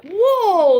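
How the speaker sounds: loud, high drawn-out "whoa"; startled. Retold or imitated. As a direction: reenact being jolted, voice leaping up. Retelling a startling first kiss.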